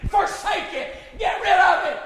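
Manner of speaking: shouted high and loud; full-force preacher shout; preaching shout. Real, not acted. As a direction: cry it out to the congregation. Preacher building to a cry.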